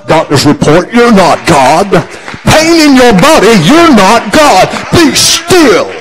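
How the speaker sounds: building high, full-force preacher's shout; shouted rebuke. Real, not acted. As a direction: rebuke it at the top of your voice. Rebuking illness at sermon's climax.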